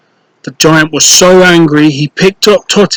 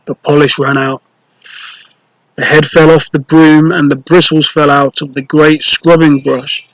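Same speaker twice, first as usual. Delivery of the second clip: plain reading voice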